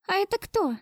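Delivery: put-on high-pitched small child asking; child's little voice. A narrator's reading. Boy asking father about a stranger, child's voice. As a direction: ask it as a little boy would. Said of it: "детский голосок".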